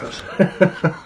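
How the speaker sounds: laughing. Real, not acted. Laughs after coughing fit.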